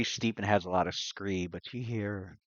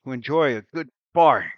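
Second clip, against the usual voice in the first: loud, grand declamation, pitched up; deep feminine voice; 6 semitones higher, 13 dB louder than usual. Acted. Game master as newcomer addressing bargain-lovers, booming theatrical voice. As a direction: hail them boldly in character. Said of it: "deep feminine voice".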